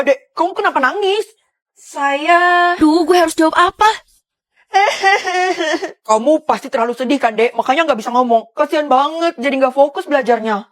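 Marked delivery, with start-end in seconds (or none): 4.7-6.0 hammed-up fake sob; fake crying. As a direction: pretend to cry to fool the guard. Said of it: "gue harus pura-pura sedih".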